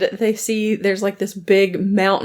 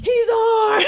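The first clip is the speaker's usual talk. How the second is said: high, laughing mock alarm; alarmed shout; 13 semitones higher than usual. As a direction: yell it out in mock panic. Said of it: "then they're like".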